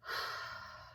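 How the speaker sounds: long breathy sigh; audible exhale before going on. Real, not acted. Pauses after telling the incident.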